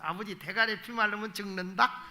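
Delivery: ordinary talk, nothing marked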